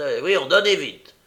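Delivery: loud hurried demand; impatient loud order. A narrator's reading. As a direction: hurry him, irritated. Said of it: "avec impatience".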